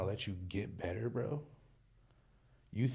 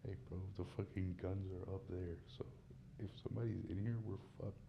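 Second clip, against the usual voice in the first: hushed warning; 2 semitones lower, 14 dB quieter than usual. Real, not acted. A guy hushing his friend about a possible intruder, hushed warning. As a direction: keep it down, someone might hear.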